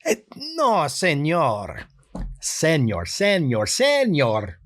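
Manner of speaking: put-on a nice guy pleading in a Spanish accent. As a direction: beg off sweetly in a Spanish accent. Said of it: "that sort of pleading quality".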